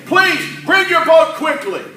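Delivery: high urgent shout to the other boat; yelling across the water. Acted. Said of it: "we yelled at some of our partners".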